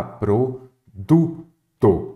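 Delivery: PRODUTO broken into slow syllables, pro-du-to. Shown as a demonstration. Teacher finding the tonic syllable for students, PRODUTO slowly syllable by syllable. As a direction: sound out "produto" very slowly, leaning on DU. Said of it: "aquela que você fala mais forte".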